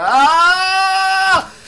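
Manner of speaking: long high shout of dismay; real cry as he dies; sudden loud ah of frustration; loud frustrated yell. Real, not acted. Sudden death in game.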